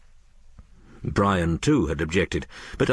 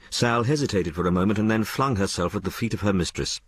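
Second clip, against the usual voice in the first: plain reading voice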